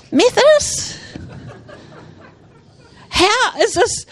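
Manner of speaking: stunned question to God; incredulous. Retold or imitated. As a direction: gasp out Methodist in disbelief. Surprised at Methodist church.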